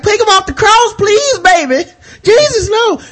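high, wailing mock plea; pleading Mary. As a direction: beg up high for him to be spared. Mary begs at the cross.

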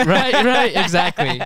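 high, loud words through real laughter; laughing agreement. Real, not acted. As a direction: agree while cracking up. Cracking up while agreeing with co-host.

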